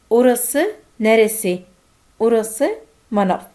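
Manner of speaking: very slow, each syllable drawn out; slow Turkish dialogue demo. Shown as a demonstration. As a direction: model the question and answer slowly.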